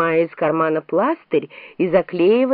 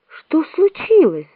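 alarmed question; 9 semitones higher, 3 dB louder, slower than usual. A narrator's reading. Alice alarmed by the screaming.

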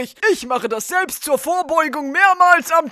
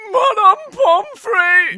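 slow, higher whine; whimpering plea; 5 semitones higher, slower than usual. Acted. Sick boy whining for the nurse, slow and higher. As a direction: drag yourself in calling for help.

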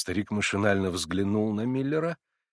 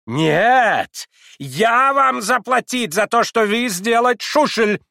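high, loud, slow German cry; frantic German shout; 12 semitones higher, 8 dB louder, slower than usual. A narrator's reading. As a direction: exclaim it wildly in broken Russian. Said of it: "неистово вскричал Адам Иванович Шульц".

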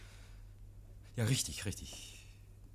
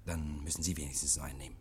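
geflüstert; 10 semitones lower, 8 dB quieter, faster than usual. Acted. Oak drängt leise zum Trinken.

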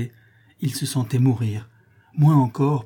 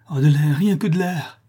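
higher, louder cry for air; desperate plea; 5 semitones higher, 6 dB louder than usual. Acted. Suffocating man begs his lover for air, desperate plea. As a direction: plead urgently, voice lifted.

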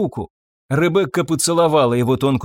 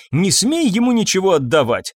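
ordinary delivery, nothing marked

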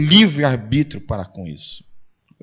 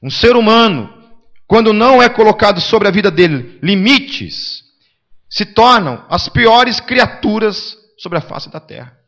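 preaching climax; 6 semitones higher, 9 dB louder than usual. Acted. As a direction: thunder it out to the pews.

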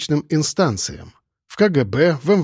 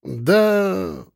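drawn-out, noncommittal "Да", pitched higher; hesitant, lingering answer; vague drawl; 5 semitones higher than usual. Acted. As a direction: stretch the word out uncertainly. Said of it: "неопределённо протянул я".

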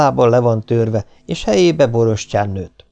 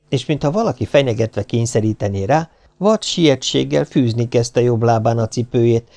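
plain reading voice

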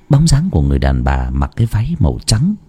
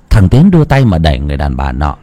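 usual speaking voice

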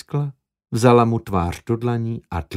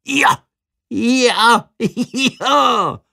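much higher, giddy yes yes; euphoric; 12 semitones higher, 3 dB louder, much slower than usual. Acted. Alone in the toilet after her kiss, euphoric. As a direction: bounce it out like leaping to the ceiling.

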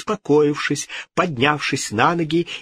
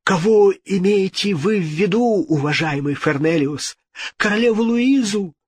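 puzzled; 8 semitones higher than usual. A narrator's reading. Puzzled king questioning the ghost.

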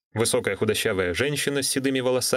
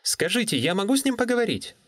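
put-on higher young woman asking; polite, higher young woman; 12 semitones higher than usual. Natalie on the phone.

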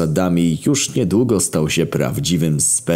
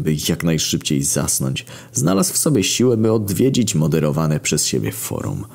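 usual speaking voice

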